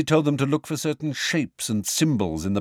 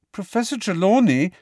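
much higher, louder offended protest; hurt and indignant; 10 semitones higher, 5 dB louder than usual. Acted. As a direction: cry out wounded on your teacher's behalf. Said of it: "in a hurt and indignant voice".

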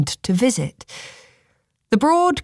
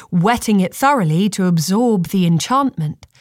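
plain reading voice

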